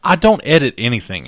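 loud defensive yell; shouting match. Real, not acted. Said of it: "Don't yell at me".

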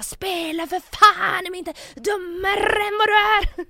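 very high, loud, drawn-out shriek in imitation; acted-out furious screech, every word stretched; imitated scream. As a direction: mimic someone screaming their vocal cords out. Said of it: "hur högt hon skriker".

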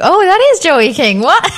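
high, slow dawning realisation; retold astonishment. Retelling their surprise at recognising her.

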